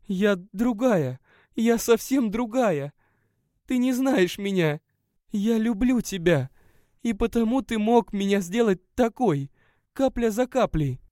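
she feels emptied out; despairing woman. A narrator's reading. Narrator voices the guest. (женщина в отчаянии, медленно)

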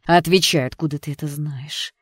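forceful start, then contained anger; angry interrogation, mildly hissed; hissed demand. A narrator's reading. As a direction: demand an answer through your teeth. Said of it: "прошипела Джесс".

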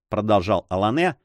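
plain reading voice

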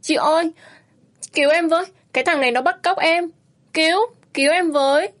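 loud high cry for help; calling for help. A narrator's reading. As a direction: desperately call out to the shop woman. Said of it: "vội kêu lên". (kêu cứu hoảng hốt)

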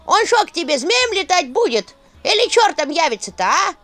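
high, rattling mocking skull; cackling skull voice. A narrator's reading. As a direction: jeer like a clattering skull. Skull mocks the witch.

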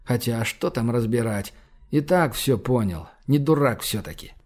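ordinary delivery

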